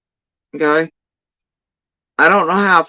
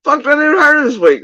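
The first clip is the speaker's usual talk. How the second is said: mock outrage; 10 semitones higher, 3 dB louder than usual. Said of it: "I'm like".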